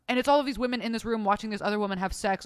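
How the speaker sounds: ordinary delivery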